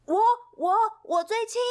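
high, loud eager "me, me"; eager shout. Acted. Pip shouts to friends that he is lightest, high and eager. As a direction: shout it out, desperate to be picked. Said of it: "皮皮马上大声说".

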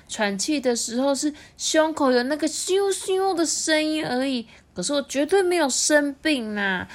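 put-on high whiny little boy, protesting; sulky child voice. A narrator's reading. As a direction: pout and whine like a stubborn kid. (赌气的小孩声音)

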